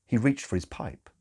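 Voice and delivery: ordinary delivery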